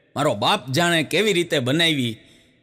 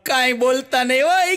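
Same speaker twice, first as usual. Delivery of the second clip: mock crying; 7 semitones higher than usual. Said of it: "રોવા માંડ્યા".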